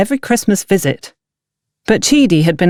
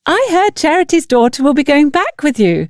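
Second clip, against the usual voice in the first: high, bubbly village woman, pleasantly surprised; cheerful sister-in-law; 10 semitones higher than usual. Acted. Bridget greets Antonia at the market, high and delighted. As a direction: voice Bridget bright and high, delighted to see her.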